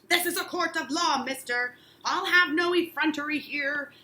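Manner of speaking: loud, indignant judge reproof; stern judge. Acted. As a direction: slap down the insolence. Danforth scolds Giles.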